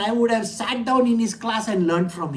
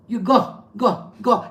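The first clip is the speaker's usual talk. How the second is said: lightly voiced brusque guru shooing me off; a hint of an impatient master sending someone off; curt guru dismissal. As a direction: wave the student away with a terse go, go, go. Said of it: "He'll talk like this to me".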